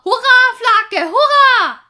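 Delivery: high, loud victory cheer; chorus cheer. Acted. Vikings cheering their home village, loud and high. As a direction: shout it like the whole crew. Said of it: "alle schrien im Chor".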